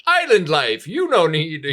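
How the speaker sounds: high, loud hammed-up Jamaican accent; mock island lilt, Jamaican accent; bad Jamaican accent. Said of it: "like, a bad fake Jamaican accent".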